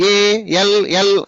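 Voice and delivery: ALL slow, each letter apart; divided letters. Shown as a demonstration. Teacher showing pupils what not to say, ALL slowly divided. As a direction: break ALL up with gaps between letters. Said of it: "అనకూడదు".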